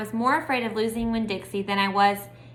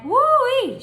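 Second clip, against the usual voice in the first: woo-wee exclamation; 7 dB louder than usual. A narrator's reading. Gloria exclaiming.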